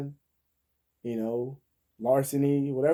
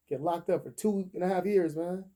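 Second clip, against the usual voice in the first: ordinary talk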